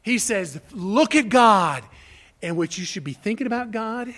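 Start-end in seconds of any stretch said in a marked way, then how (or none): 0.7-1.8 loud, slow command; emphatic exhortation. Retold or imitated. Preacher quoting a call to look to God, loud exhortation. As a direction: punch out each word as an urgent call.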